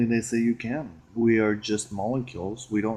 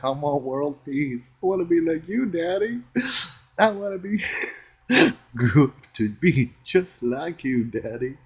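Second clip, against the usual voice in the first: put-on high whiny kid wanting to be like Daddy; childlike longing; 7 semitones higher, 6 dB louder than usual. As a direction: beg Daddy like a little kid.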